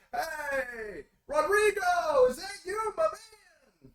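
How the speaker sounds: oily radio host working the crowd; gushing pundit. Acted. Pundit gushes and calls out.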